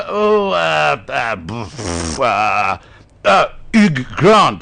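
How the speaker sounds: hesitate, then proclaim it in mock French; fumbling mock French. Racking brains, French name attempt.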